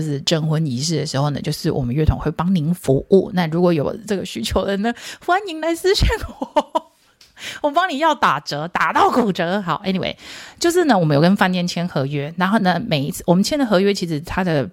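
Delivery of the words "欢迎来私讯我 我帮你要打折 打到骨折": high, giggly plug; playful laughing invitation; laughing pitch. Real, not acted. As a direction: laugh through the sales line. Joking sales pitch to listeners.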